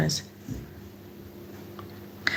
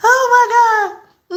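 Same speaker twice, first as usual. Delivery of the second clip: delighted squeal; 6 dB louder, slower than usual. Real, not acted. Reacting to chat teasing.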